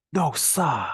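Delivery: high exclaimed "No, sir" in a Boston accent, "sir" as "sa"; Boston "No, sa!" in disbelief. Shown as a demonstration. Teacher performing Boston "No, sir" for no way. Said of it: "what's common in the Boston area is to say".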